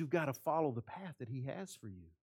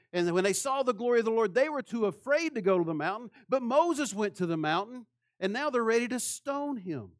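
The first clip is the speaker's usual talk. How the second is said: louder, higher preacher's build; preaching at full force; 6 semitones higher, 7 dB louder than usual. Acted. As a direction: drive it home to the congregation. Preaching climax.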